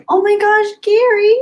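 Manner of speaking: high, loud squeal of surprise; overjoyed surprise. Real, not acted. Streamer reacting to a friend's super chat, high delighted squeal. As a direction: squeal his name in delight.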